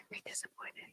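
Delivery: laughing whispered repeat. Retold or imitated. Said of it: "that from your parents".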